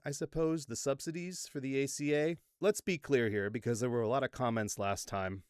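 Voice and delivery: ordinary delivery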